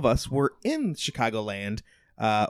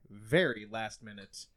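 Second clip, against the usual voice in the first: ordinary talk, nothing marked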